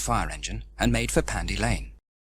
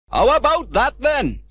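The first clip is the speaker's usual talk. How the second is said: proud and puffing; 13 semitones higher, 9 dB louder, slower than usual. Acted. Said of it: "said Trevor proudly, in between puffs and pants".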